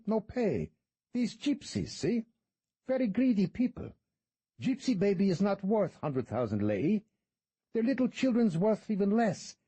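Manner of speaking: a smirking fixer naming his price; shady tour guide. Acted. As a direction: play the sly, haggling guide. Guide's voice, no speech tag.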